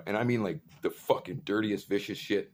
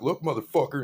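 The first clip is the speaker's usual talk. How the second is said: raised, loud and confrontational; retold angry challenge; 6 semitones higher, 6 dB louder than usual. Speaker stepping in against the yelling dude, angry challenge. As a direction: step in loudly, spoiling for a fight.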